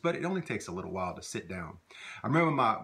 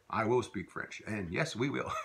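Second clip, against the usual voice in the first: usual speaking voice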